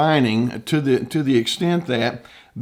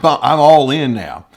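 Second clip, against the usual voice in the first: a hammed-up narcissist, louder on "all in"; mock defiant shout; 2 semitones higher, 8 dB louder than usual. Therapist mimicking a narcissist, mock defiant and loud. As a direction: puff it up like a stubborn narcissist.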